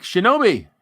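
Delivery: name called out loud and high; excited shout. Real, not acted. Reading donor names to chat, loud call.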